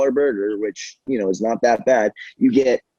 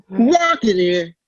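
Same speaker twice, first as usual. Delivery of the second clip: slow, high Walken-style delivery; hammed-up Walken; Chris Walken impression; 8 semitones higher, slower than usual. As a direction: stretch both words like Walken. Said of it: "does a great Chris Walken impression".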